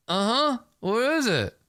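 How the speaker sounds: high, wary question. Acted. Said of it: "I'm like".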